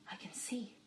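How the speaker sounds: soft unvoiced whisper. A narrator's reading. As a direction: breathe the words barely aloud. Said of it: "Adiver whispered".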